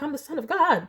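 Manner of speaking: high, lightly voiced boy's matter-of-fact answer; cheeky young Jesus. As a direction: toss it off like a smug kid.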